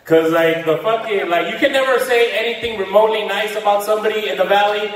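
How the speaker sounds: usual speaking voice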